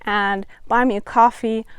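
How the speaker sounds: normal talking voice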